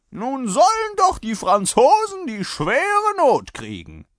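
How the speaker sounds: high, loud, slow curse; defiant rider. A narrator's reading. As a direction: hurl a drawn-out battle curse. (trotziger Fluch, laut und gedehnt)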